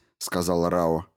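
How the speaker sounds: ordinary delivery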